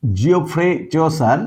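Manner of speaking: Geoffrey চোসার said slowly and deliberately; slow, careful naming. Shown as a demonstration. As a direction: spell out Geoffrey চোসার slowly for students to remember. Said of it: "খুব ভালো করে নামটা". (ধীরে, স্পষ্ট করে নাম বলা)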